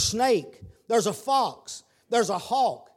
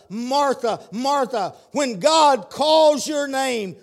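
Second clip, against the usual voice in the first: calling out; 7 semitones higher, 8 dB louder, slower than usual. Acted. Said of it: "He said that to Martha".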